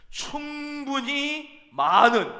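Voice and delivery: 충분히 많은 drawn out slow and high; slow emphasis. Acted. (충분히 많은 천천히 높게 강조)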